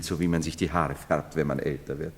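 usual speaking voice

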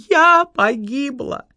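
медленно, высоко, срываясь в плач; причитать, разрыдавшись к концу; сквозь рыдание. A narrator's reading. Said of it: "проговорила Лариса Львовна сквозь рыдание". (рыдая, с нарастающим плачем)

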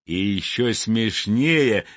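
ordinary delivery, nothing marked

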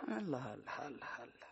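soft, low sarcastic "Allah, Allah"; a quiet sardonic mutter; muttered sarcasm. Acted. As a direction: grumble it under your breath. Mother mutters sarcastic disbelief.

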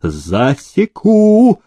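high, long-drawn threat; drawn-out angry cry; furious bellow. A narrator's reading. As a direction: bellow it as a master's threat, stretched out. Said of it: "Демидов и заорал".

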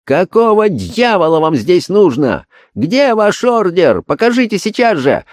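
very high enraged shout; furious roar. Acted. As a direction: roar at the intruders in rage. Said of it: "разъярённо заревел". (яростный рёв)

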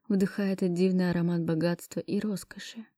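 plain reading voice

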